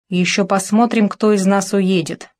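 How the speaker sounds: ordinary delivery, nothing marked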